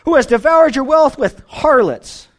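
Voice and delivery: jealous brother resenting the returned son; jealousy. Retold or imitated. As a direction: spit it out with jealous scorn. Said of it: "And jealousy".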